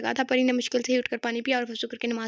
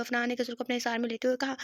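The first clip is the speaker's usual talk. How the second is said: ordinary delivery, nothing marked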